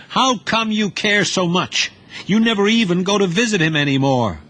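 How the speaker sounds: high, quick youth voice throwing it back; a boy turning the blame on his uncle; young Duty, accusing. Acted.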